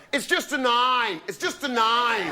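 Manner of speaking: lightly done Australian brushing it off; imitating Aussie friend. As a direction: shrug it off like the Aussie mate. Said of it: "my Aussie friend went".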